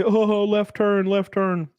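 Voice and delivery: slow teasing singsong "left turn"; mocking taunt. Kids teasing a NASCAR fan, slow and mocking. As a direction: drag it out to tease a fan. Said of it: "make fun of me and say".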